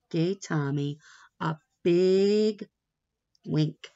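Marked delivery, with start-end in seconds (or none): none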